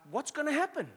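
high-pitched retold worry; terrified. Speaker retells being terrified in the pipe, high anxious voice. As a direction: imitate your own panicked question. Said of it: "I was terrified".

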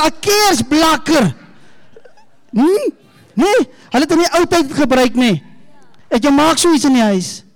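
put-on bright, high storytelling to kids; talking to children. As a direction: coax the little ones like a children's pastor. Talks to children, props.

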